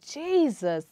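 high, loud calling out of the name in a dream; screaming Jesus. Retold or imitated. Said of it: "I scream real loud".

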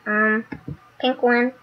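ordinary talk, nothing marked

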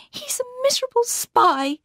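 very high-pitched outburst; high exclamation of loathing; distressed cry. A narrator's reading. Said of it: "she cried".

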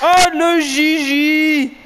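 very slow, high and loud stretched cry; drawn-out cheer. Real, not acted. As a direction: stretch it out like a stadium chant. Celebrating the striker.

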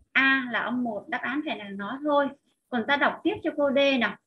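usual speaking voice

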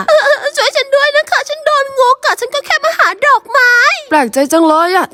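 put-on a girl pleading for help in the forest; young woman voice. (เสียงหญิงสาวสูงหวาน)

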